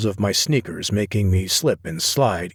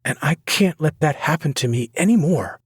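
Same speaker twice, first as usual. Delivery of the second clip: hurt resolve; 7 semitones higher than usual. A narrator's reading. Sloane's final resolve.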